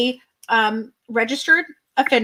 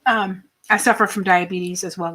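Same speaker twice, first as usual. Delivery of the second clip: normal talking voice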